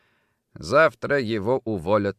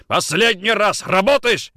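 loud, high threatening question; furious ultimatum; 11 semitones higher, 6 dB louder than usual. Acted. Nikitin seizing Kochkin again, furious ultimatum. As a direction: shout a last demand. Final violent demand to suspect.

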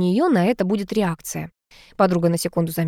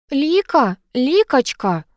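higher, coaxing call; ingratiating call; 6 semitones higher, much slower than usual. A narrator's reading. Cat familiar calls his upset mistress, ingratiating. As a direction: wheedle gently, each name falling away. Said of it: "заискивающе звал меня".

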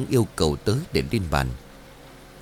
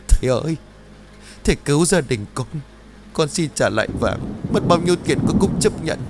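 high, louder sobbing plea; crying loudly; 5 semitones higher than usual. Acted. Trang crying loudly, pleading with master Lương. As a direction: cry out to the master for help. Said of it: "lại càng khóc lớn".

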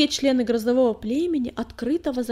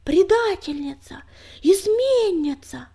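high, loud crowd outcry; angry yells; 8 semitones higher, 8 dB louder, slower than usual. A narrator's reading. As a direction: yell it out in fury. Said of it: "злобные вопли".

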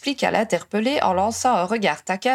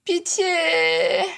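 sepulchral voice; 8 semitones higher than usual. A narrator's reading. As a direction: wail it like a voice from the grave. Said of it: "Une voix sépulcrale les fit tous sursauter".

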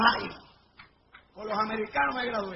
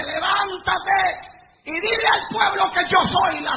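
booming divine command; 5 semitones higher, 5 dB louder, slower than usual. Acted. God's command retold in sermon.

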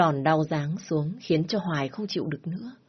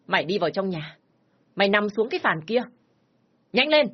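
stern command building to a high yell; angry order; 3 semitones higher than usual. Acted. Father ordering his daughter onto the bench, yelling. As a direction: order her down, then explode.

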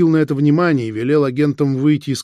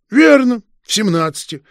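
higher, louder delighted cry; gleeful exclaim; 8 semitones higher, 6 dB louder than usual. A narrator's reading. Detective catching the suspect's slip, delighted exclamation. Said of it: "обрадованно воскликнул фон Шпинне".

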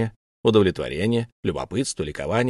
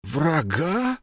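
a touch of mock offence, higher; feigned offended dignity; 7 semitones higher than usual. A narrator's reading. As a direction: rise on «Врага?» as if insulted. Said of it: "изобразил оскорблённое достоинство". (с наигранной оскорблённостью)

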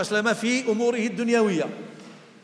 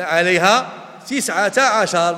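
Quran recitation; 7 dB louder, slower than usual. Shown as a demonstration. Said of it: "كما قال الله تعالى".

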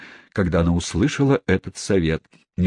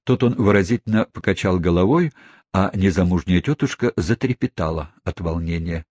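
plain reading voice

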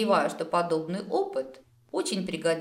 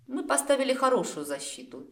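slightly haughty, higher-pitched reply; 8 semitones higher than usual. A narrator's reading. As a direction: answer with a touch of superiority. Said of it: "чуть высокомерно пожала плечами".

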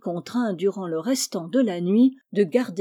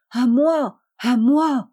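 loud, higher desperate call for help; heart-rending cry; 5 semitones higher, 7 dB louder, slower than usual. Acted. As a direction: cry out as if the bear will kill him. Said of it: "d'une voix déchirante". (cri déchirant, fort et aigu)

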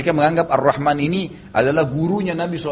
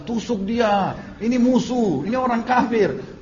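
high-pitched retold battle cry; storyteller acting an urgent command; urging on; 8 semitones higher, slower than usual. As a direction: egg the group on, voice lifted high. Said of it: "Bilal terus bilang".

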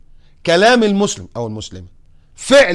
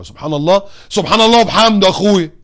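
defiant comeback; 5 semitones higher, 8 dB louder than usual. Retold or imitated. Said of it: "بتحدي يعني؟".

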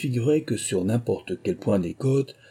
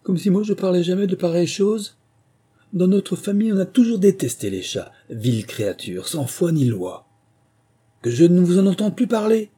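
high, loud indignant tirade; offended storybook mouse laying down the law; genuinely angry outburst; 8 semitones higher, 5 dB louder than usual. A narrator's reading. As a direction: scold in outrage, pitched well up. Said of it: "on voyait bien qu'elle était fâchée pour de bon".

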